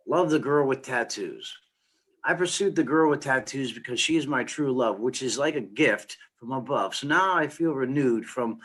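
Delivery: spoken-word love poem, running from rhyme to rhyme; poem recital. Acted. Poet reading a love poem about a tattooed girl, spoken-word recital. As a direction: recite the rhymes in a rolling stream.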